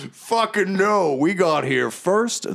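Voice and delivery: high, slow blowhard voice; laughing refusal. Acted. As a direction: scoff at the request with drawn-out relish. Blowhard refuses to give rods.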